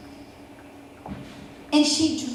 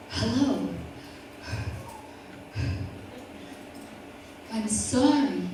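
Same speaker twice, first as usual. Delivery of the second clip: lightly voiced breathless principal; low, quiet, winded delivery; out of breath; 3 semitones lower, 8 dB quieter than usual. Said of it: "He's still out of breath".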